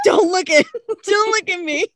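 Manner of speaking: high, hammed-up embarrassed plea; mock panic. Host hiding face from fans, high mock-panicked plea. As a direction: beg them to look away, half laughing.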